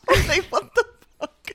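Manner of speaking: high, real giggling disbelief; helpless laughter. Real, not acted. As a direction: squeal it out while cracking up. Cracking up at the accent.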